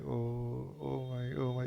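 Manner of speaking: soft, mild mock fretting; fretful oh my. Teacher mimicking an anxious striver, mild soft fretting. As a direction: sigh it out like a worried seeker, lightly. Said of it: "It's not like".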